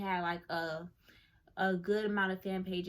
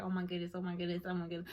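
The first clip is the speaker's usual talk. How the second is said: fast starstruck mutter; 3 dB quieter, much faster than usual. Retold or imitated.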